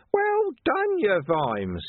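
high, mocking cheer. A narrator's reading. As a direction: cheer him on sarcastically. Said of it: "Carcer cheered".

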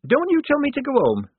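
high, loud angry outburst; rounding on her. Acted. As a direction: cut her off in indignant fury. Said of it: "said Shufti, rounding on her".

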